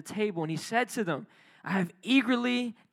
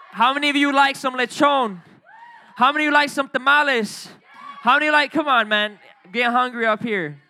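loud, high, building food roll call; excited hype; 4 semitones higher, 6 dB louder than usual. Real, not acted. Pastor listing holiday foods to the congregation, loud and excited. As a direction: get the room hungry and hyped.

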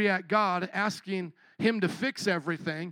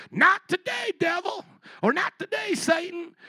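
mock rebuke; 9 semitones higher than usual. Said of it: "what are you gonna say back".

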